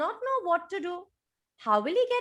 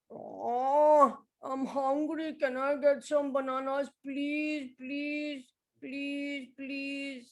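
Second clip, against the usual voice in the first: pleading elephant; much slower than usual. Acted. Elephant begging banana seller.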